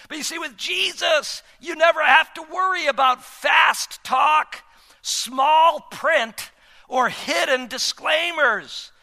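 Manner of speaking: fervent, loud preaching. Acted. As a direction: preach it with rising force. Preaching at full force.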